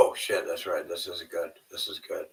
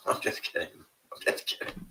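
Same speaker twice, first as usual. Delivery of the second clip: whispered; 5 semitones lower, 8 dB quieter than usual. Real, not acted. Jokey unvoiced aside.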